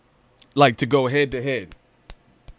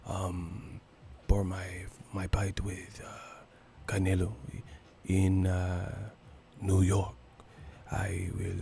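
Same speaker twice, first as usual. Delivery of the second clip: near-whisper, much softer and lower; Cotto whispering impression; 6 semitones lower, 13 dB quieter, slower than usual. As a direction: mumble it softly like a soft-spoken fighter at the mic. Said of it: "Cotto be whispering on the mic".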